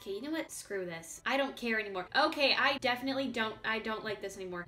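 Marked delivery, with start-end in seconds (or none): none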